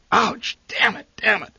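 high, loud yelps of pain; pained cursing. A narrator's reading. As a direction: burnt thumb, curse sharply. Match burns father's thumb.

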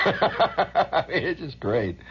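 laughing high through the words; breaking into laughter. Real, not acted. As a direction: giggle it out.

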